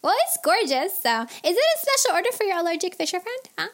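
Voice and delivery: high, loud, gushing voice; gush, then rush out the question; high-pitched, quick busybody; chatty angel fisher, nosy. A narrator's reading.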